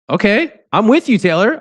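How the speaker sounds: high, eager "I'm with you"; enthusiastic agreement. Real, not acted. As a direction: cheer him on, pitch way up. Host cheering on guest's view.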